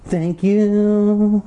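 put-on Jelly Boy thanking the reader, pitched high. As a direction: chirp it back in the creepy kid voice. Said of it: "Excellent work, Jelly Boy".

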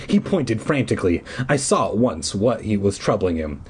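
usual speaking voice

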